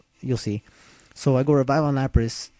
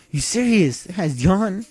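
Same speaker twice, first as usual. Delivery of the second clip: disbelief; 6 semitones higher than usual. Retold or imitated. Opponent uses Yawn.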